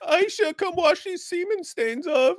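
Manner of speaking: very high-pitched crybaby falsetto; mock whiny summons. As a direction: call out in a hammed-up whine. Same acted crying figure.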